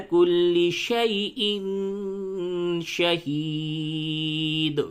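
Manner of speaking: slow, high, drawn-out tajweed chant; Quran recitation. Shown as a demonstration. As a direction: recite it slowly with full tajweed.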